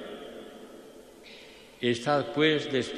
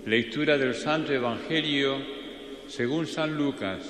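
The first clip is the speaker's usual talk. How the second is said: cathedral echo; 5 semitones higher than usual. Acted. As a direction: intone it in a resonant church. Announcing the gospel reading.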